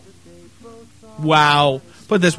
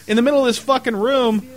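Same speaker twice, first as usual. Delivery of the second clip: normal talking voice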